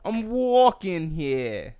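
high, very stretched New York vowels; New York accent. Shown as a demonstration. Host practising the classic line, drawn-out New York accent. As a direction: drag it out like a New Yorker. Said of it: "in a New York accent".

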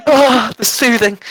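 high, slow words through laughter; laughing. Real, not acted. Cracking up at the milk joke, laughing. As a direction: gasp it out mid-laugh.

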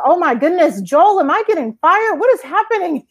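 high, alarmed squeak of worry; mock panic. Asking boss Joel in alarm if she is being fired, high mock panic. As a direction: play it as sudden comic dread.

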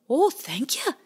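high little otter thanks; thankful and sweet; grateful otter. Acted. Bowie thanks the blackbird, high grateful.

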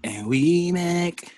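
drawn out. Real, not acted. Slow drawn catchphrase.

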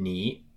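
你 in a low dipping tone; third tone. Shown as a demonstration. Teacher showing the word for you, third tone. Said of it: "in the third tone means you".

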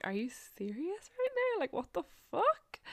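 high, incredulous "what the fuck"; mock disbelief. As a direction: squeal at him as if he can't be serious. Retelling her shock at him.

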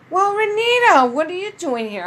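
high, loud friendly hail; mock-surprised friend calling to her at the Twins game; voicing her friend. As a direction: call out her name like a surprised buddy. Friend Aaron greets her, acted.

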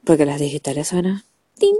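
normal talking voice

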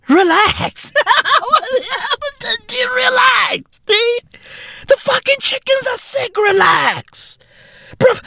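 high-pitched, laughing exasperation; exasperated. Retold or imitated. Comedian tells panicking egg buyers to relax, exasperated. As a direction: plead in a squeal of disbelief.

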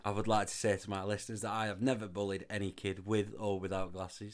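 low, quiet mock-solemn statement; mock-formal disclaimer. Podcaster denying bullying to listeners, mock-solemn. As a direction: drop low and soft, like an official denial.

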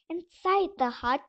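usual speaking voice

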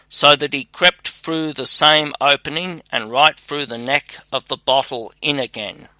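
ordinary delivery

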